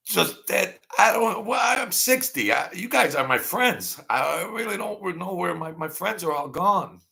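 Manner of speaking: high, plaintive outpouring; tearing up. Real, not acted. Sixty-year-old tells viewers his friends are gone, tearful high voice. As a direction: let the voice climb as tears threaten. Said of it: "look, I'm tearing up".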